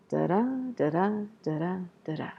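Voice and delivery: da-da chanted with stress on each second da; iambic da-DA beat. Shown as a demonstration. Teacher chanting the iambic beat for students. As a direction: tap out the da-da rhythm. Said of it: "so that you can hear".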